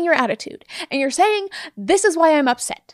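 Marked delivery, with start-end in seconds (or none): 1.8-2.9 mock attack mode. Shown as a demonstration. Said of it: "you're talking like this".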